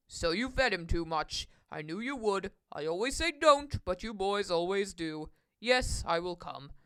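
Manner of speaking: put-on low-pitched, knowing older man; deep voice, weary patience; resigned Mister Carp. A narrator's reading. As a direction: gently scold in a deep voice.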